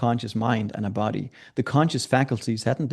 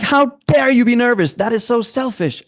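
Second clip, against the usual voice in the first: lightly voiced mentor, higher and louder scolding; half-mock scold, raised and pushed; 11 semitones higher, 5 dB louder than usual. Mentor scolds the nervous speaker backstage, mock stern. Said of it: "And he goes like".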